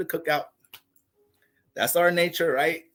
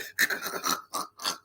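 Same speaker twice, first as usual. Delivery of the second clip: breathy laugh; 7 dB quieter than usual. Real, not acted. Laughs at his own girl voice.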